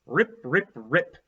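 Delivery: ordinary delivery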